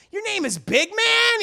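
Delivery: high, loud question swooping up; hammed-up disbelief at the name; mock incredulity. Men question the dwarf's name, acted incredulous squawk.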